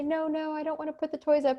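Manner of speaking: put-on high, fast childish protest; whiny child. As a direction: rattle off the refusal like a kid. Student refusing to tidy up.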